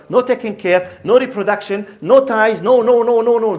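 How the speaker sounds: fervent sermon build-up; preaching. Acted. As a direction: hammer each "no" home to the crowd. Emphatic list of what is lost.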